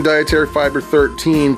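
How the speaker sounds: ordinary talk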